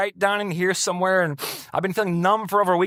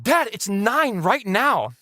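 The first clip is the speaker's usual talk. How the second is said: high, drawn-out mock exasperation; retold exasperation; 7 semitones higher, much slower than usual. Kids telling Dad he is already late, exasperated. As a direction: stretch it out at a clueless parent.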